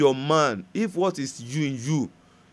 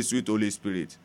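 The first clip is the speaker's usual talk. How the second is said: quiet prayer; 6 semitones lower, 3 dB quieter than usual. Acted. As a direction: pray it softly and earnestly.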